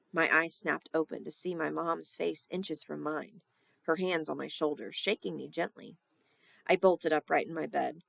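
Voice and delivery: ordinary delivery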